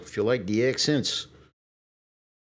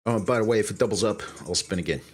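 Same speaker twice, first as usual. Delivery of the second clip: ordinary talk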